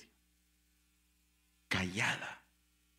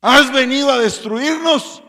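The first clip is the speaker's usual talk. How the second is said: demon shouting; 13 semitones higher, 12 dB louder than usual. A narrator's reading. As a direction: bellow it in terror and rage. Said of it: "gritó a gran voz".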